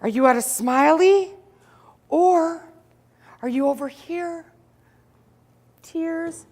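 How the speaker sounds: mock the pain-scale question scornfully; husband mocking. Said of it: "my husband's like".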